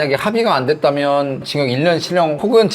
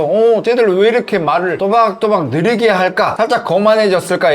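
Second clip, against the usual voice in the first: slow deliberate speech; 4 semitones higher, slower than usual. Retold or imitated. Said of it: "영사님들이 보시면서".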